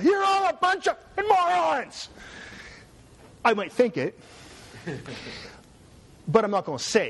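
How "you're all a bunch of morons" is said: high, slow mock shout at the room; hammed-up yell of contempt; mock outburst. Hypothetical rant at a Senate meeting, mock shout. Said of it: "stand up and say".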